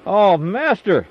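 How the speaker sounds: high, loud and slow, crying out in dismay; anguished cry. A narrator's reading. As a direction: wail it out over the lost axe head. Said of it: "he cried and said".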